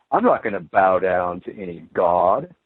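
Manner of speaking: lightly mock a smug refusal; a hint of sneering defiance; mock defiant skeptic. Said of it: "we're taught that that's absolutely stupid".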